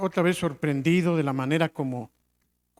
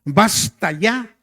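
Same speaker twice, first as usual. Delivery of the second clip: "Basta ya" muy lento, solemne, como un apóstol que reprende; Pedro severo; 4 dB louder, much slower than usual. Retold or imitated. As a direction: alarga "Basta ya" con autoridad. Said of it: "decía el apóstol Pedro". (el apóstol Pedro reprendiendo, lento y solemne)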